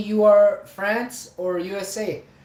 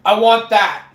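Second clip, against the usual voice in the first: retold demand; 3 semitones higher, 8 dB louder than usual.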